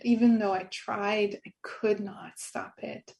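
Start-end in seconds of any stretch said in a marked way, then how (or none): 1.5-3.1 "I could not stop it" breathed out slowly, nearly voiceless; hushed echo. Real, not acted. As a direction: let "I could not stop it" fall away to a soft hush. Echoing herself in a hush.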